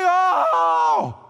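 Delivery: high hammed-up moan of misery; groaning cry. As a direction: let out a long, dramatic groan of suffering. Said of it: "It's like".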